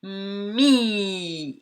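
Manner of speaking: "Mi" as a hummed m sliding into long i; stretched sounds. Shown as a demonstration. Teacher showing the mouth opening, "Mi" drawn out. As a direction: stretch "Mi" out, both sounds held. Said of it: "elle ouvre".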